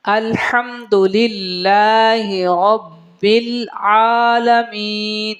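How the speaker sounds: slow, chanted tajweed with long held vowels; Quranic recitation. Shown as a demonstration. Teacher recites for students, slow melodic Quranic chant. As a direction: intone each phrase, sustaining the endings.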